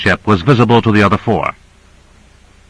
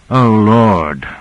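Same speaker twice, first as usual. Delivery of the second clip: a resigned, helpless sigh of a phrase; helpless resignation. A narrator's reading. Michaels on hearing of Brownian motion, resigned and helpless. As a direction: give up on it gently. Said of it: "of helpless and resigned understanding".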